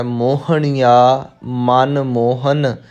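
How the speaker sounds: slow ਮੋਹਣੀਆ ਮਨ ਮੋਹਨ, ending on a clear n; careful model reading. Shown as a demonstration. Teacher modelling the reading, slow careful ਮੋਹਣੀਆ ਮਨ ਮੋਹਨ. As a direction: spell out ਮੋਹਣੀਆ ਮਨ ਮੋਹਨ slowly, word by word. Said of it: "ਇੱਥੇ ਜੋ ਪਾਠ ਆਪਾਂ ਕਰਨਾ ਹੈ".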